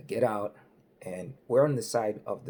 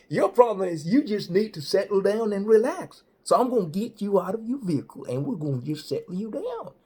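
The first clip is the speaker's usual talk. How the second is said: hammed-up highway patrolman; state trooper voice; 7 semitones higher, 7 dB louder than usual. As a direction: play the big-voiced cop laying down the law. Trooper lectures the young man.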